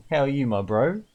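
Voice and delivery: ordinary talk, nothing marked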